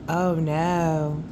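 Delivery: ordinary delivery, nothing marked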